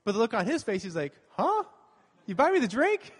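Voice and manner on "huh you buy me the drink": high, loud mock disbelief; imitated astonishment. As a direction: act out a stunned man's incredulous question, pitched way up. Retelling elderly man's astonished reaction.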